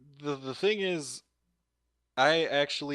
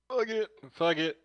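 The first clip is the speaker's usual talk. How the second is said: much higher, playful fuck it; sing-song "Fuck it." pitched way up; high mock motto; 6 semitones higher than usual. Shown as a demonstration. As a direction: toss off "Fuck it." high like a catchphrase. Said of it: "That'll be my motto for the day".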